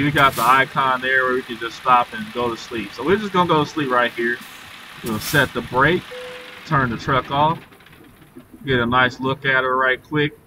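ordinary delivery

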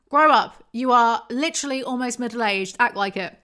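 exasperated loud scolding. Pretend. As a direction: yell at them to grow up. Yelling at character.

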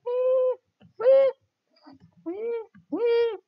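high, loud playful squeals; gleeful wee. Real, not acted. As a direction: squeal like on a slide. Gleeful cries while falling.

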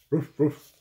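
two short loud woofs; dog bark. Shown as a demonstration. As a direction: give two quick dog barks. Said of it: "bark like a dog".

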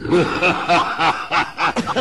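kurzes, verschmitztes Auflachen; listiges Lachen. Acted. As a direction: lach verschlagen über den eigenen Plan. Narr lacht über seinen Plan. (der Narr lacht listig über seinen Streich)

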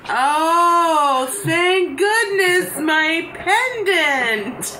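high, slow, drawn-out relief; mock relieved lady. Voicing the woman getting her pendant, high and slow. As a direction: stretch it out like a swooning lady.

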